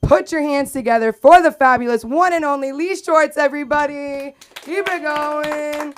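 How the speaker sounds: high, loud hype introduction; stage announcer. Real, not acted. Host brings up the headliner to applause, hyped announcing. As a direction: whip up the crowd, stretching the last words.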